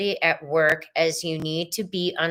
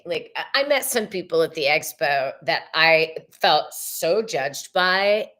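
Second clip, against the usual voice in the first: normal talking voice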